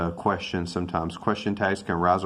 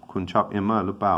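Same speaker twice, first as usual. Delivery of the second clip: rattled off quickly in Thai; quick Thai gloss of the question; fast Thai translation; much faster than usual. Shown as a demonstration. Teacher translating for Thai learners, quickly. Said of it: "ผมไม่แน่ใจ".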